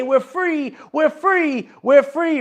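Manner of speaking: high, rising joyful cry of we're free; joyful cheer. Retold or imitated. People celebrating their new freedom, joyful high cheer. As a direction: lift it up high like a crowd celebrating. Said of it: "the people are gonna say".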